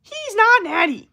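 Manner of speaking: extremely high, loud, drawn-out squeal; Greg Doucette. Acted. Fitness YouTuber accusing someone on video, mimicked as a loud squeal. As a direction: shriek it slowly in a piercing falsetto impression. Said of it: "that's literally what Greg Doucette fucking sounds like".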